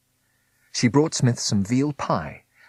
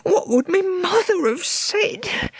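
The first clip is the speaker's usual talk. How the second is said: much higher, slow, fretting; fretful old Meg; 4 dB louder, slower than usual. A narrator's reading. Meg frets about her mother, high slow.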